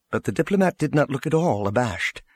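ordinary delivery

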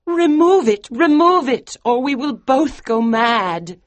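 very high, loud pleading, slowing at the end; desperate cry. A narrator's reading. Wife begging her husband to operate, high desperate cry. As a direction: beg him in a high, frantic cry. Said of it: "cried Georgiana".

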